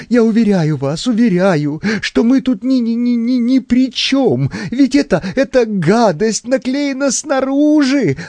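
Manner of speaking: high, stammering panic; nervous shock. A narrator's reading. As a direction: plead innocence in a high, rattled voice. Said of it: "стал проявлять симптомы глубокого нервного потрясения".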